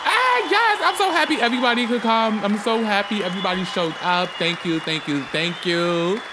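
a manic, far-off character voice playing the grand host; over-the-top host persona. Podcast host greets his audience in a manic, shouting showman voice. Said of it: "I don't know what that intro was".

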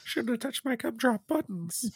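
high cartoon cookie voice; high gingerbread man voice.

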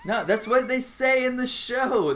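normal talking voice

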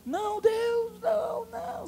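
high, crying plea to God; desperate pleading. Acted. David begging God for his son, anguished high plea. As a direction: beg God like your child is dying.

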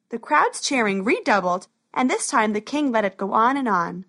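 ordinary delivery, nothing marked

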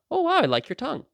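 high, swooping wonder; mock admiration. Replying to a child sticking out their tongue, mock-admiring. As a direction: sound innocently impressed, pitched right up.

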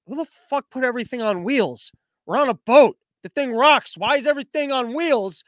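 a captain yelling at his crew over cargo on wheels; hammed-up baffled shout; exasperated captain. Ship captain exasperated at rolling cargo.

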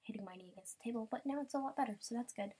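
ordinary delivery

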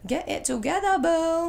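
teasing coo. Pretend. Teasing high mock scold.

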